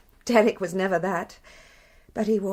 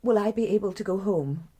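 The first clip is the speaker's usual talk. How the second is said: ordinary talk, nothing marked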